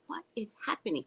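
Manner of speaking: an unvoiced, alarmed whisper. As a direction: hush it like the bee might hear you. Panic as the bumblebee enters.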